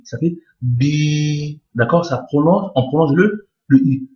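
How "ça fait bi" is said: bi with the i stretched very long, slow and soft; long vowel bi. Shown as a demonstration. Teacher modelling the long Arabic i for students, very slow. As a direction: draw out the i in bi. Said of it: "ça indique qu'il faut prolonger le i".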